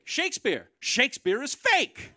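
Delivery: hammed-up shocked outcry; mock outrage. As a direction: shriek it in fake shock. Comic outraged punchline.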